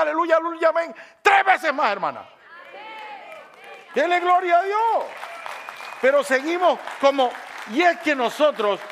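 high, loud, impassioned exhortation; full-force preaching. Real, not acted. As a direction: shout it out to rouse the congregation.

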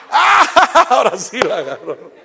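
loud, high burst of real laughter; laughing. Real, not acted. Preacher laughing at the joke's twist, real laughter. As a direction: laugh out the punchline. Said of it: "preacher laughing at his story".

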